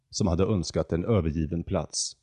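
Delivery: ordinary delivery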